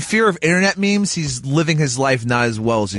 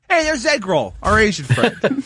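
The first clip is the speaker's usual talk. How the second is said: higher-pitched hail to a buddy; mock jovial greeting; 6 semitones higher than usual. Greeting a friend named after ethnic food, mock jovial. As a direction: call out a mock-friendly introduction.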